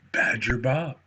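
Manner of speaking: ordinary delivery, nothing marked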